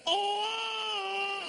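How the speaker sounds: shrill-free high shouted "Oh!" like a car horn; pedestrian's horn. Retold or imitated. Comic horn-blast at a man on the wrong side of the escalator. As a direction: blare it out like a horn at a stranger. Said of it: "Someone on the wrong side of an escalator".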